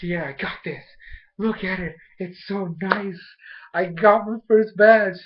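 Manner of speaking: imitated triumph. Ash wins his first badge, imitated proud excitement. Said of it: "he's like".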